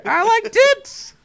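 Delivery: high, loud excited squeal; excited Charlie Day. Retold or imitated. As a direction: squeal it like an overexcited goof. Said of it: "sounded like a very excited Charlie Day".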